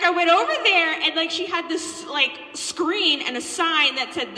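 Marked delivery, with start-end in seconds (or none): none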